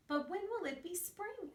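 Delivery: high, pleading little bear; high cub voice. Acted. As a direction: ask like an eager cub. Alfie asks.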